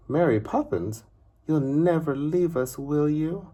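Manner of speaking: put-on small boy begging her to stay; pleading boy. Child Michael pleading with nanny.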